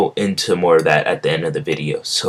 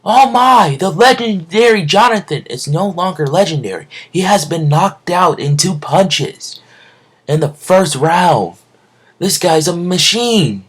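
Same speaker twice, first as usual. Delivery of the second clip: much higher, slowed announcer voice calling the knockout; excited announcer; 12 semitones higher, 3 dB louder than usual. Acted. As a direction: hype it up like a ringside announcer. Ringside announcer calls the knockout.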